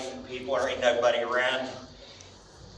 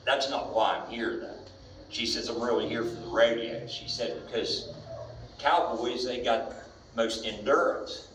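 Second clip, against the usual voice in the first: lower, softer flirty cowgirl voice; quoted cowgirl, low; 5 semitones lower, 4 dB quieter than usual. Retold or imitated. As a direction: lean in and purr it low.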